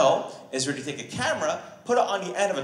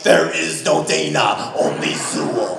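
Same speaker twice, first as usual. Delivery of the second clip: slow, very low possessed growl; Zuul demon voice; 3 semitones lower, 4 dB louder, slower than usual. Retold or imitated. Girlfriend as the Ghostbusters demon, slow, low possessed growl. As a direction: sound possessed, dragging the words deep. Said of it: "she's like".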